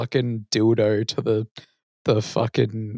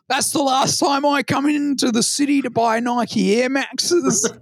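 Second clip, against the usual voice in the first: mock a cocky eshay, pitched way up; aussie eshay slang; 12 semitones higher than usual. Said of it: "And he's like".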